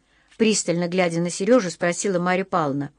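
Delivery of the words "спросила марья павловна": ordinary delivery, nothing marked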